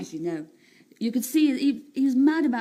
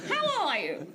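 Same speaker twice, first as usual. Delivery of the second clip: high, plummy put-on posh accent; 5 semitones higher, slower than usual. Shown as a demonstration. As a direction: greet them in an exaggerated posh accent. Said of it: "I can do a posh accent".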